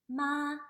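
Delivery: "ma" high, loud and level; first tone. Shown as a demonstration. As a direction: hold "ma" on one high note. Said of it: "the first tone".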